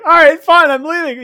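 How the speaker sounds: high, loud mock huff; squeaky exaggerated sulk; mocking friend voice. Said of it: "and then you're like".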